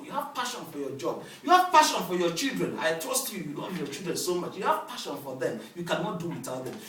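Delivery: normal talking voice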